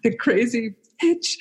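usual speaking voice

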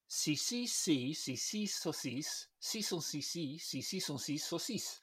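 quick French si and saucisse drill; French tongue twister. Shown as a demonstration. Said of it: "I think it goes something like".

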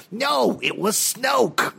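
drawn-out, high mock-dramatic delivery; Carrie Fisher. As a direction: stretch each word into a hammed-up cry. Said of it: "the dialogue they gave Carrie".

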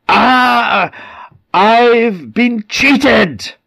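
high, loud, drawn-out cry of betrayal; shocked outcry. Acted. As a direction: wail it out as the spirit is robbed. Possessed man suddenly thwarted, shocked.

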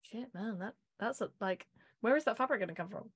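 muttered aside. Retold or imitated. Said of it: "I was like".

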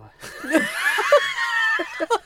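high loud burst of laughter; shrieking laugh. Real, not acted. Reading superstition, bursts out.

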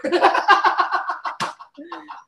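high wheezing laugh; helpless laughter. Real, not acted. Comic cracking up at his own retelling, high laugh.

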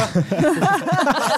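short laugh. Real, not acted. Laughs at the grim joke.

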